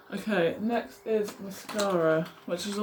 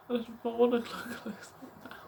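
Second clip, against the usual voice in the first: whispered laugh; 4 semitones higher than usual. Real, not acted. Shy laugh at close-up view.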